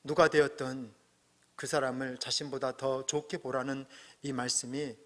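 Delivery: normal talking voice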